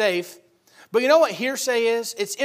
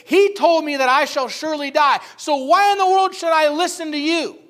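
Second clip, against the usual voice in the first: Eve talking back; 6 semitones higher, 6 dB louder than usual. Retold or imitated. Preacher voices Eve rebuking the devil.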